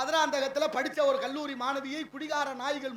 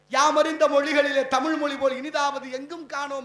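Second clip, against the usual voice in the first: louder, ringing recital of யாமறிந்த; declaimed quote; 8 dB louder than usual. Shown as a demonstration. Speaker to audience, proclaiming Bharathi's verse loudly. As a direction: proclaim Bharathi's line to the hall. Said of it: "Bharathi சொன்ன வார்த்தை".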